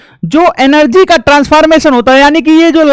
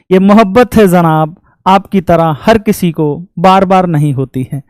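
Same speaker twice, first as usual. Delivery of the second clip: lower, slowing, recited like a poem; shayari recitation; 5 semitones lower than usual. Acted. Teacher recites a love couplet to his class, lightly dramatic. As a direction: declaim the couplet with feeling. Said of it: "एक line याद आ गया कि सोचा कि सुना देता हूं".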